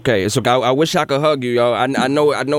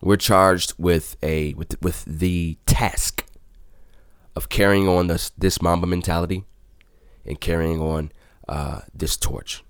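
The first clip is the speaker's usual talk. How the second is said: somber, solemn; 5 semitones lower, 5 dB quieter, slower than usual. Real, not acted. Somber tribute to Kobe Bryant.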